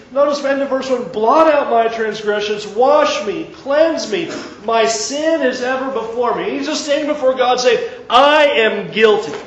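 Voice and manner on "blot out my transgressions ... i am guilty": high impassioned psalm reading; fervent preaching. Acted. Preacher voicing David before God, fervent and raised. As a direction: drive each plea home.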